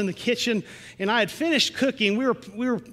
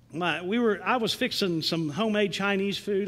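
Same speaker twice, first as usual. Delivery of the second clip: ordinary talk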